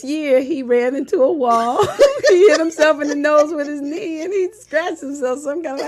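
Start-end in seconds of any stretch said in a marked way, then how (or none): 2.3-5.9 laughing hard through the words. Real, not acted. Mother laughing retelling her son's bump. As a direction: giggle it out, louder.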